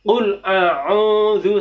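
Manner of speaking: "Qul a'udzu" with a plain o vowel; wrong pronunciation. Shown as a demonstration.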